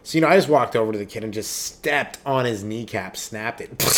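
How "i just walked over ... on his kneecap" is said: normal talking voice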